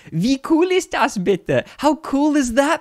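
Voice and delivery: put-on high, squeaky little-boy quote; imitated excited kid. Translating the German boy for viewers, imitated kid voice. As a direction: pipe it up like a kid bragging.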